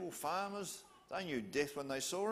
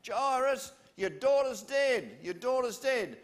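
loud calling shout over the crowd; yelled across crowd; 9 semitones higher, 9 dB louder, slower than usual. Acted. Friend yelling to Jairus across the crowd, loud call. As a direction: shout the bad news across the heads. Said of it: "Yell out to him then".

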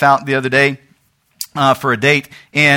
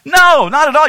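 emphatic; 9 semitones higher, 5 dB louder than usual. Real, not acted. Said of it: "preacher rejecting the flat reaction".

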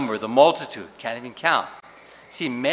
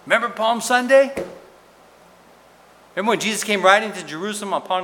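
rousing question; 9 semitones higher, 6 dB louder, faster than usual. Real, not acted. Preacher rouses congregation.

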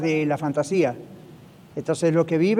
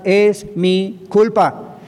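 very slow, "Es" pressed, falling end; slow confession; much slower than usual. Shown as a demonstration. Pastor modelling a confession for listeners, slow and grave. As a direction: draw out "Es mi culpa" word by word. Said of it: "Hay que aprender a confesar".

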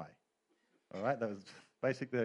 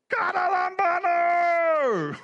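huge, high, long-drawn war cry; battle-cry yell; 14 dB louder, much slower than usual. Retold or imitated. Lacrosse player running across the field yelling, wild drawn-out battle cry. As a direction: yell it out at full force, stretching every syllable. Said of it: "running across the field yelling".

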